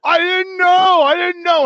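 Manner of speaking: mock the guy's whiny protest; whiny pleading. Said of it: "standing in front of me going".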